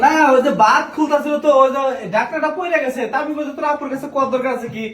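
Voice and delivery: high flustered laughing excuse; nervous laughing. Acted. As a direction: laugh off getting caught. Caught, making nervous excuses.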